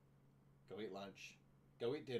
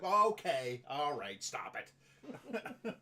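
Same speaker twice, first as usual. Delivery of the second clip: mock dismissal; 3 semitones higher, 7 dB louder, much slower than usual. Acting friends cutting off the liar.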